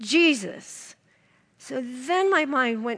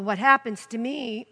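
ordinary talk

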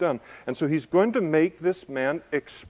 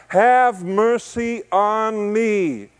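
slow, higher and louder plea; pleading cry; 8 semitones higher, 5 dB louder, much slower than usual. Retold or imitated. Beggar pleading with Jesus by the road, slow raised cry. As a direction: stretch out the plea for mercy. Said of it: "And he cried out".

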